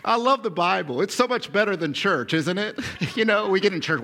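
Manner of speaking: normal talking voice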